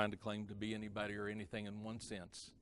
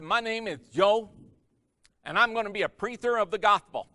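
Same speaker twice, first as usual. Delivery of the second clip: Joe blurting it out much higher and louder; 7 semitones higher, 9 dB louder than usual. Retold or imitated. Young student Joe boldly telling the guys. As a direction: announce it proudly, pitched up high. Joe announcing himself, speech impediment.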